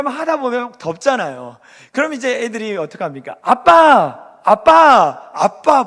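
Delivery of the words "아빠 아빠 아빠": put-on loud calls of a kid for dad; children calling dad. Kids at the park calling their dad, loud repeated calls. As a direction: call out for dad across the park. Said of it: "부릅니다".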